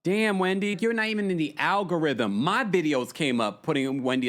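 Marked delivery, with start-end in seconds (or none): none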